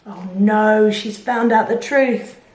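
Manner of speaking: usual speaking voice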